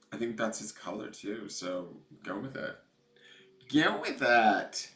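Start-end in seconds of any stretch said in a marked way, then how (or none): 3.7-4.9 slow, loud, higher GO WITH IT, drawn out; emphatic cheer. Real, not acted. Urging a viewer to follow the purple, drawn-out cheer. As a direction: stretch each word of "Go with it" and push it out. Repeats advice as drawn-out cry.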